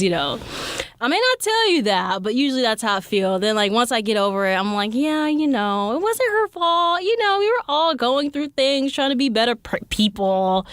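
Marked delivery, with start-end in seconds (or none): 4.9-10.7 forgiving voice. Retold or imitated. Forgiving inner voice.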